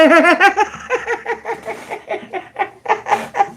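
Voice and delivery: high, breathy burst of laughter; long laugh. Real, not acted. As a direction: laugh helplessly at your own joke. Laughs at her own joke.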